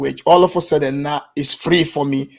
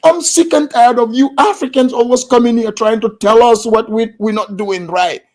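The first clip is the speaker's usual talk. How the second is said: higher-pitched retold angry outburst; losing her cool; 6 semitones higher, 3 dB louder than usual. Angry meeting-goer scolding the African speaker, mock outburst. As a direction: act out a fed-up rant in a raised pitch. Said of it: "She lost her cool".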